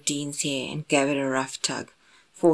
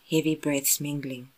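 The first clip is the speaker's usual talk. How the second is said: usual speaking voice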